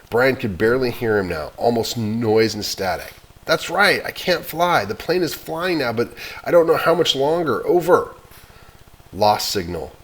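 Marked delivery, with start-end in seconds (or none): 3.4-8.1 higher, louder anxious answer. Acted. Boy telling the operator he can't fly, scared.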